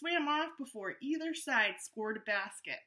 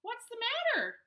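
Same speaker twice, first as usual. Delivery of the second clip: much higher concerned little-shark voice; Gilbert's high voice checking on a crying remora; Gilbert asking; 13 semitones higher, 4 dB louder, faster than usual. Acted. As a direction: ask gently in a high child voice. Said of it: "Gilbert asked".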